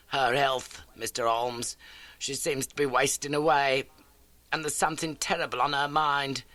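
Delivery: a lightly voiced elderly landlady; homely, eager old lodging-house keeper. A narrator's reading. Landlady greets Holmes, lightly voiced homely.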